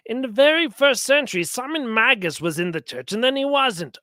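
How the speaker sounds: ordinary talk, nothing marked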